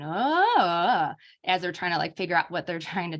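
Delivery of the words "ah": rising jargon "Ah". Shown as a demonstration. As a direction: sing the "Ah" like a child's wordless jargon. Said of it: "that rising intonation".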